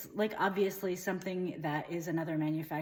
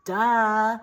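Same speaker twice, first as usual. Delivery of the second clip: loud, scoffing duh; mock duh; 3 semitones higher, 10 dB louder than usual. Comic character scoffing at the audience, loud duh. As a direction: blurt it like a snotty know-it-all.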